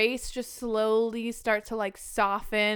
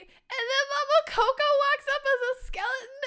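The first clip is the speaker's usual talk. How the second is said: very high, tearful retelling; choked up; 4 dB louder than usual. Real, not acted. Fan retells grandmother arriving as skeleton, choked up. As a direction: let the voice climb toward tears. Overcome retelling reunion scene.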